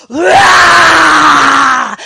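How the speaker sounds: loud, high roaring monster. Pretend. Monster attacking the man in the parking lot, roaring. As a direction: roar like a movie monster. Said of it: "this monster being like".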